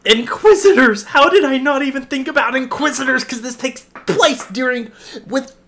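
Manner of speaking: high, loud, shrieking excitement; ecstatic disbelief. Real, not acted. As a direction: rave at a squeal, beside yourself. Overwhelmed after trailer reveal.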